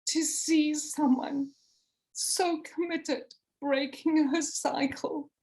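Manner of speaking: real emotion breaking through; emotional, voice catching. Real, not acted. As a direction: hold back tears as you speak. Moved recalling friend's story.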